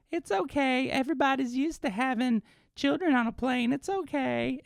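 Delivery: very high, put-on grandma voice; imitated grandmother. Grandmother excusing the screaming child on a plane, imitated high voice. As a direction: play a soothing grandma in high falsetto. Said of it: "the grandmother's just like".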